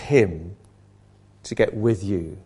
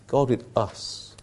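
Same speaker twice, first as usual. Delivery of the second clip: soft hushed refrain; 2 semitones lower, 5 dB quieter, slower than usual. Shown as a demonstration. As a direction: let "God with us" sink into a hush. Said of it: "repeated refrain, softly".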